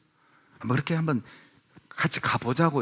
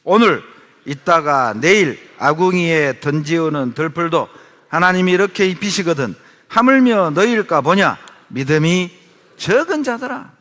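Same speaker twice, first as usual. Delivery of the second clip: booming preacher's reading voice; scripture read aloud; 5 semitones higher, 9 dB louder than usual. Shown as a demonstration. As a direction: lead the congregation through the verse. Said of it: "다 같이 삼십 절 읽습니다".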